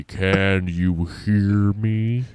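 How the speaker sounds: very slow careful call. Acted. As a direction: stretch each word out gently. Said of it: "he said slowly".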